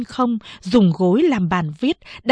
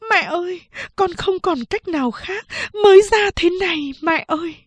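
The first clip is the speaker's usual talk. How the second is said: tearful plea, pitched much higher; crying while speaking; 10 semitones higher than usual. Acted. Daughter-in-law weeping as she shows the baby. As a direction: beg through tears. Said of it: "vừa khóc vừa nói".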